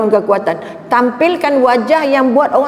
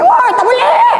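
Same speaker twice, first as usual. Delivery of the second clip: high, loud mock shout with a laugh; mock yell; 3 dB louder, slower than usual. Driver shouting at the helpful old man, hammed-up yell. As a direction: yell it like being robbed. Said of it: "Yang awak nak jerit".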